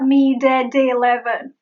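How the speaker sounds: "meet at eleven" with heavy Ds for the Ts; full D. Shown as a demonstration. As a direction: stop hard on D: mee-dad-deleven. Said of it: "It is not meet at eleven".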